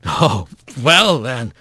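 slow, low resignation; sluggish, woozy acceptance; groggy Bobcat. A narrator's reading. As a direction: give in wearily, dragging the words. Dazed Bobcat gives in.

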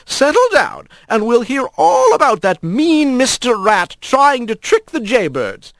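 grandma playfully asking which story; smiling grandma. A narrator's reading. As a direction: ask with a smile in the voice.

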